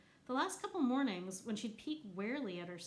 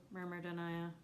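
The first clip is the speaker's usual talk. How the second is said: ordinary delivery, nothing marked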